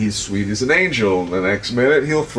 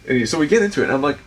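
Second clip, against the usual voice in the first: normal talking voice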